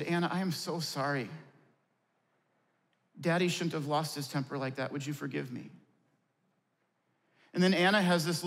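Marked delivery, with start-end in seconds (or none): 0.0-5.7 put-on soft, low and gentle, kneeling to a child; quiet, sincere sorry to a little girl; tender apology. Father apologizing softly to daughter.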